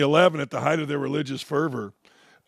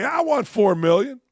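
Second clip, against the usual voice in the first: raised, higher voice of a player demanding; mock player demand; 7 semitones higher, 3 dB louder than usual. Host imitating a player demanding four million, higher pitched. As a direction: play the greedy young player asking for more. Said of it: "hearing a player say".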